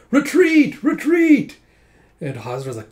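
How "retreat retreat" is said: very high, loud imitated battle cry; shouted retreat. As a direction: yell the alarm across the field. Retold soldiers sounding the retreat.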